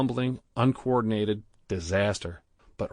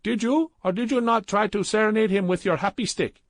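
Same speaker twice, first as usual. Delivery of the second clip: put-on high, loud scolding Indian accent; 12 semitones higher, 5 dB louder than usual. A narrator's reading. Gupta confronting Badrul, loud scolding Indian accent. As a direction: scold him like a betrayed partner. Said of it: "Gupta scolded".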